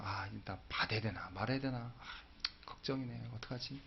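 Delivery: whispered fretting to himself; worried whisper. Retold or imitated. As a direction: breathe the worry out, barely voiced. Inner worry at dinner.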